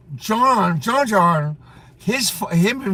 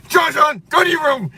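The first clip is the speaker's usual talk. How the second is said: mock father shout; 9 semitones higher than usual. Teasing John John with his father's order, shouted. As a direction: yell it at the kid like his father did. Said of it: "when your father used to tell you".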